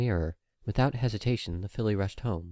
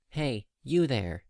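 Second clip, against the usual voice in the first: slow, higher call across the crowd; 5 semitones higher, much slower than usual. Acted. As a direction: catch the filly's attention. Officer calling to filly.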